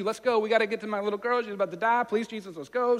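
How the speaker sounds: high, loud, urgent begging; desperate father urging Jesus on; frantic pleading. Retold or imitated. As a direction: hurry him along in a panic.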